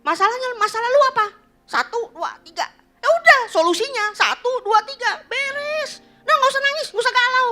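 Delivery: loud, high, rapid-fire brushing-off; impatient parent. Acted. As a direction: act out a busy parent rushing through a child's problem. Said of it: "kita kan gini kan". (orang tua tak sabaran, nada tinggi dan keras)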